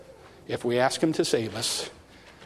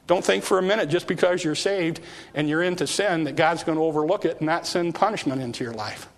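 usual speaking voice

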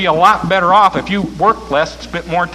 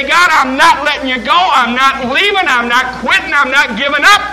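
determined prayer; 9 semitones higher, 6 dB louder than usual. Acted.

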